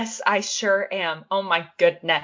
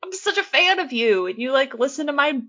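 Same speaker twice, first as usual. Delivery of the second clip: high, squealing mock fan gush; starstruck fan; 5 semitones higher than usual. Retelling meeting a fan who listens, high starstruck squeal. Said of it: "I was like".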